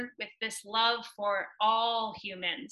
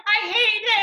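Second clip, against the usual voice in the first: high, loud, exaggerated complaint; hammed-up petulant protest; mock whine; 10 semitones higher, 7 dB louder than usual. Told she is feeling at last, a high, loud, hammed-up protest.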